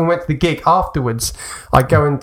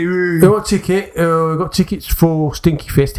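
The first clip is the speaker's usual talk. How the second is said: put-on Southern English accent; 4 semitones higher than usual. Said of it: "always Southern people selling the tickets".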